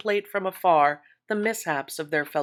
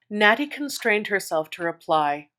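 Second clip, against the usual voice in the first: ordinary delivery, nothing marked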